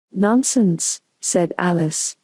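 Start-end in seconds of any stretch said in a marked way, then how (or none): none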